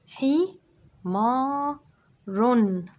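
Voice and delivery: high and loud, رن ending in a clear n; syllable by syllable. Shown as a demonstration. Teacher sounds out the word so children hear the tanween. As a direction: sound out each syllable, ringing the final رن. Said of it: "سمعت صوت جديد؟".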